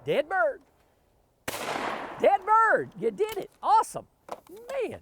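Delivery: high, loud cheer for a hit; excited cheering. Real, not acted. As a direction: celebrate the shot at the top of your voice. Celebrating a hit target.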